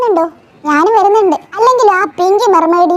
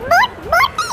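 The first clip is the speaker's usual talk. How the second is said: high-pitched frantic squeal; panicked child; 9 semitones higher, 4 dB quieter than usual. Acted. Child crying to Shiva that water is flooding the boat, panicked.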